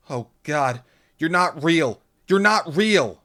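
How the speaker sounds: high, loud horrified cry; tearful disbelief building. A narrator's reading. Lauren breaks down, crying.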